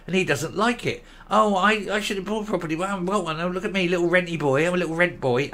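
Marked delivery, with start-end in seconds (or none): 1.3-4.7 higher, put-on simpering voice; mock whiny renter. Vlogger mocking the first minister as a renter, higher simpering mock voice. 4.7-5.5 sing-song self-pity on "rent boy", lightly mocked; mock whiny renter. Vlogger mimicking the politician, simpering mock "I'm a little rent boy".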